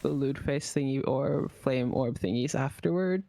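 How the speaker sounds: ordinary talk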